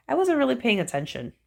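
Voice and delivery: plain reading voice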